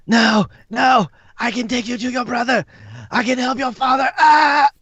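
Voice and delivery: high-pitched desperate pleading; acted panicked cry, pitched far up; screaming plea. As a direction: beg in a strained high yell. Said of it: "it begins screaming. Um, and he yells".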